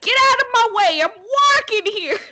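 very high, loud character yell; pushy guy shouting. Acted. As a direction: yell it like an irate pedestrian. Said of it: "there's, like, a guy out there who's just like".

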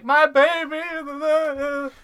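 put-on high, loud, slow wail of my baby; drawn-out sob-voiced my baby; mock crying parent. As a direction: ham up a weepy parent at prom. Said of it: "I'm gonna cry, be like".

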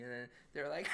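ordinary talk

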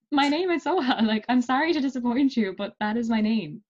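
high, loud exasperated insistence; fed-up mock apology; indignant retort. Acted retort about her name.

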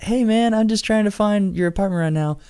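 delivery driver politely asking the customer for directions, high pitched; friendly high greeting. Retold or imitated. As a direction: sound extra friendly and high. His own quoted call to boyfriend.

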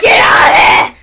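very loud shouted order; hammed-up angry yell; mock shout. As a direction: yell it to throw her out. Shouts at sister.

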